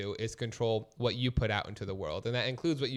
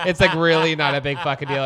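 louder, insistent repeat; emphatic; 3 semitones higher, 11 dB louder than usual. Real, not acted. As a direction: hammer the point home.

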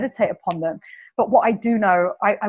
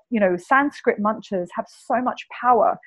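normal talking voice

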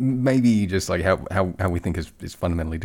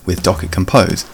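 upbeat, louder sponsor read over music; 9 dB louder than usual. Acted. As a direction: sell it like a radio ad. Host reads sponsor ad over music.

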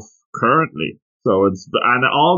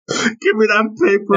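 mock yell; 7 semitones higher than usual. Co-host echoes the mock yell.

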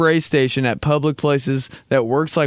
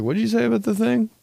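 high, quick, innocent question; breezy high-pitched pretence of not having heard; feigned obliviousness; 9 semitones higher, faster than usual. Acted. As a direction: play dumb in a lightly raised pitch. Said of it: "I acted like I didn't hear him".

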